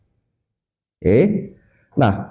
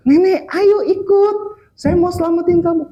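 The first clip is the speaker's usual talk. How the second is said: high-pitched rescuer calling across; urgent calling out; 11 semitones higher than usual. Retold or imitated. Rescue team to grandmother on the roof, urgent high call. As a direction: act out a rescuer hailing granny.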